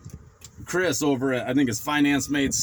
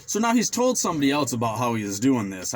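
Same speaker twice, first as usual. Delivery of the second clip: ordinary talk, nothing marked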